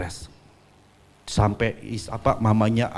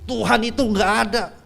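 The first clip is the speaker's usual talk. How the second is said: retold insistent protest, higher; mild stubborn shout; stubborn denial; 8 semitones higher, 3 dB louder than usual. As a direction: dig in and refuse bitterly. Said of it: "dia tetap ngotot".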